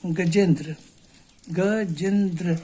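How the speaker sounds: Gajendra drawn out slowly, piece by piece; slow syllable split. Shown as a demonstration. Teacher demonstrating strokes to students, slow split. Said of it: "कितने stroke में आप बोलते हैं".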